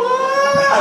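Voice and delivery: high imitated roller-coaster scream; wife screaming on the roller coaster, imitated. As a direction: mimic his wife's squeal on the ride. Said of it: "she's going".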